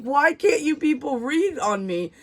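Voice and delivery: high-pitched, half-laughing mock complaint; laughing exasperation. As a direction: wail it in amused disbelief. Retold exasperation at repeated refusals.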